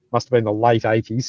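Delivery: ordinary talk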